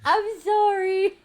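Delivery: high, loud, drawn-out wail; hammed-up slow pleading yell; mock apology. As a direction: cry out an over-the-top apology. Exaggerated apology after acted threat.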